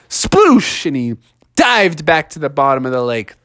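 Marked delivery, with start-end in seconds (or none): 0.1-0.8 high, bursting splash noise; splash sound. Acted. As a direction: make the water go sploosh. Monster splashes.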